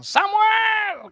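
high, loud shout; hammed-up shout of a crowd; crowd calling out. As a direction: call out to the leader as a whole nation. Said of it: "Orang Israel datang".

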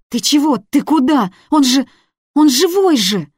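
high, loud frantic shouting; desperate scream. A narrator's reading. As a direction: scream in panic to stop him. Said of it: "что кричала".

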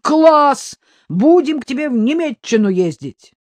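high, loud enthusiasm; exclaiming. A narrator's reading. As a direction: cheer the news on eagerly. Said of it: "воскликнул Иваныч".

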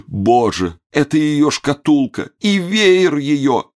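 high, slow, drawn-out lament; sobbing. A narrator's reading. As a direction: wail as if breaking into sobs. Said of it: "всхлипнул". (причитает, всхлипывая)

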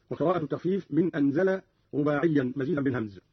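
ordinary talk, nothing marked

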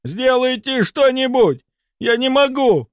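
slow, high, desperate plea; crying out in pain. A narrator's reading. Patient begs doctors for help, crying out. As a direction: beg for help in agony. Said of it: "кричал он".

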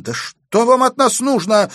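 high, loud outraged question; indignant shout. A narrator's reading. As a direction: cry out in indignation. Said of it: "возмущённо выкрикнула Аглая". (возмущённый выкрик)

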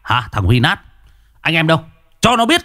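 high, angry rallying cry; angry shout. Acted. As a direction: whip up the mob to beat him. Angry mob leader rallying men. (tiếng hô giận dữ)

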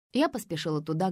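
ordinary delivery

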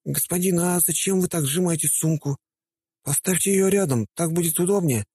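put-on high-pitched young boy; a cheeky street kid asking. A narrator's reading. Boy shoeshiner voiced.

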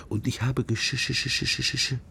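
a lightly voiced penitent stuck on the word; mock stammer of a confessing woman.

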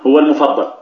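ordinary delivery

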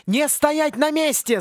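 high, slow shouted order; yelling command. Acted. Gingema yelling at the students to run. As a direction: yell at them to keep moving. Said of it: "заорала Гингема".

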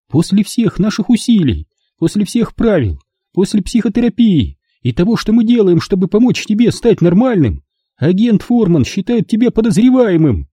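high, upset mother's voice; scolding mother. Acted. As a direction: lay into him as his mother. Mother scolding her son. (мать отчитывает сына, высоким голосом)

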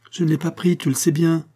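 high, insistent self-defence; indignant protest. Acted. Monique denying she took the money, indignant protest. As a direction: protest it, pitched well up.

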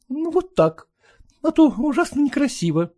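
high, fussy mother. Acted. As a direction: fuss over the dress. Mother fussing over daughter.

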